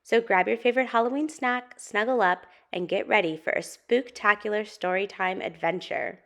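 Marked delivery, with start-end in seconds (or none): none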